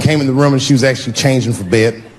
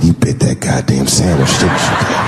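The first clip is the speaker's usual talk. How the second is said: much lower, mocking accusation; accusing wife; 11 semitones lower than usual. Wife accusing husband of biting sandwich, lower mocking voice. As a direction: accuse him, teasing and knowing.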